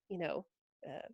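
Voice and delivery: ordinary talk, nothing marked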